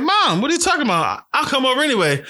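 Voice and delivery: high-pitched mock protest to mom; retold protest. Retelling his phone call to mom.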